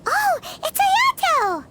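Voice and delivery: high, slow delighted cry of recognition; light up at spotting an old friend; excited surprise. Acted. Paimon spots Ayato at the festival, delighted and surprised.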